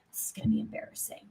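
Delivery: quick, soft whisper to oneself; muttered dread. Acted. Inner voice expecting embarrassment, whispered dread. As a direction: whisper it under your breath, fast.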